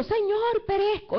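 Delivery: high, loud panicked plea; panicked cry. Acted. Peter sinking in the waves cries out to the Lord in loud panic. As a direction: cry out like a man going under. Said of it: "Fue tanto el pánico".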